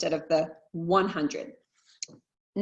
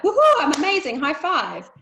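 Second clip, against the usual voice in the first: higher, louder whoop of self-congratulation; mock cheer; 7 semitones higher, 7 dB louder than usual. Imagined gym-goer after day one, mock cheering. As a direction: cheer yourself on like you just nailed it.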